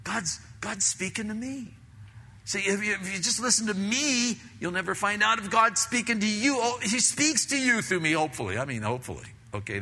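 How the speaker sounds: usual speaking voice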